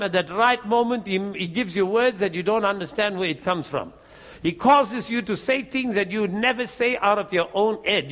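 normal talking voice

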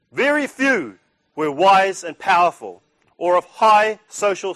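slow, emphatic Bible reading; scripture reading. Acted. Preacher reading First Corinthians aloud to the breakfast crowd, slow and emphatic. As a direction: proclaim the verses slowly to the crowd.

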